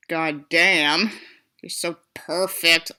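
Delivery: ordinary delivery, nothing marked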